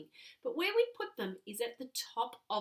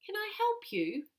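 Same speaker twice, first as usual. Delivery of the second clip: much higher, eager helpful question; Miss Uldricus offering help; 11 semitones higher, 4 dB louder than usual. Shown as a demonstration. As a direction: play the helpful neighbour calling back. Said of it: "this is how it would sound".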